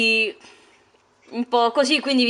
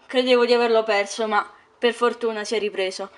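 usual speaking voice

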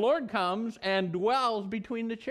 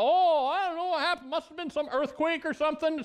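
puzzled priests; 6 semitones higher than usual. Acted. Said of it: "the priests of Dagon thought".

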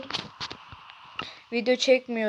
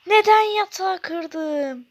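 high, loud and slow wail of regret; dismayed outcry; 7 semitones higher, 9 dB louder, slower than usual. Real, not acted. Gamer wails after breaking his own bed. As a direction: wail over the broken bed.

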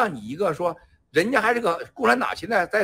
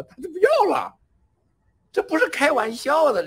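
emphatic exclamation; 10 semitones higher, 5 dB louder than usual. Real, not acted. Praising her sacrifice, voice soaring.

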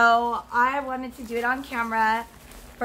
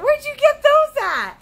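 high, loud mock boyfriend asking; boyfriend's question; 13 semitones higher, 8 dB louder than usual. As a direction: squeak out his surprised question, hammed up. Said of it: "my boyfriend's gonna be like".

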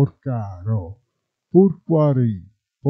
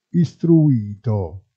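normal talking voice

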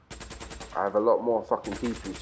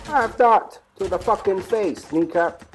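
higher, louder and slow, savouring the kill; gloating shout; 9 semitones higher, 5 dB louder, slower than usual. Real, not acted. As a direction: yell it at the enemy you just shot. Gloating over a game kill.

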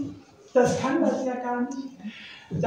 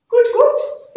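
much higher, louder bright call; cheerful bird call; 13 semitones higher, 7 dB louder than usual. Acted. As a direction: call out while flying past. Nektarvogel ruft vorbeifliegend. (fröhlicher Vogelruf, viel höher und lauter)

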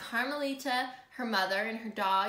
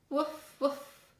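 two short, higher woofs; dog barks; 4 semitones higher than usual. Acted. Manny the dog answers a greeting, two higher barks. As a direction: bark twice, quick and high.

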